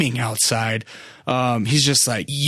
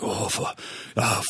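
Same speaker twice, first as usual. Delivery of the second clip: soft, broken groan of an injured man; imitated pained groaning; 3 semitones lower, 8 dB quieter than usual. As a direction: mimic words pushed out through pain. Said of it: "almost grunting and groaning".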